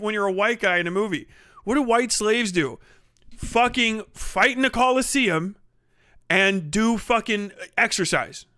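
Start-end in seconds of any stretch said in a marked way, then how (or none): none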